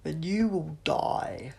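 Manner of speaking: slow, loud menacing alien; harsh alien threat. A narrator's reading. Sontaran threatening the Doctor.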